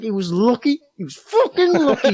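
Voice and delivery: high, laughing mock bravado; laughing through a hammed-up boast. Retold boast after the fight.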